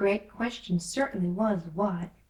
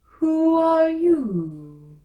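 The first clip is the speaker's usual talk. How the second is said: very slow, loud, high drawn-out drawl; slow, loud caterpillar drawl; languid sleepy caterpillar; 9 semitones higher, 11 dB louder, much slower than usual. A narrator's reading. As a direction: stretch every word out like a lazy caterpillar. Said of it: "in a languid, sleepy voice".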